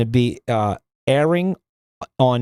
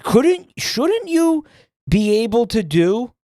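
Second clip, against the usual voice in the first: sudden realization; 7 semitones higher, slower than usual. Real, not acted. Streamer struck by an idea for Joel, surprised high blurt.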